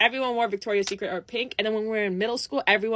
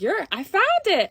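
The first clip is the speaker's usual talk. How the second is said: excited triumph; 8 semitones higher, 4 dB louder than usual. Retold or imitated. Finding last lip gloss.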